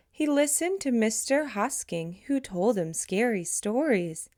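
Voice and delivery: ordinary delivery, nothing marked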